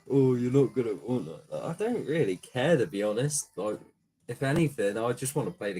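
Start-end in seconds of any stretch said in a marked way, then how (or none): 0.1-1.5 lightly mocking a smug kid; mocking gamer kid. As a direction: parrot the taunt with mild scorn. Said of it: "people like".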